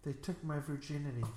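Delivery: ordinary talk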